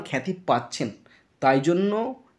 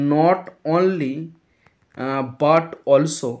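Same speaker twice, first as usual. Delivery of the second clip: not only and but also drawn out very slowly; very slow teacher emphasis on not only, but also; stressed key phrase; much slower than usual. Shown as a demonstration. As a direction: stretch each word of not only, but also for the class. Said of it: "এই যে একটা জিনিস বলছি না".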